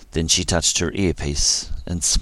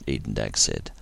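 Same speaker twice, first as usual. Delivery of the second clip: usual speaking voice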